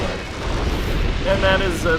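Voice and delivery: usual speaking voice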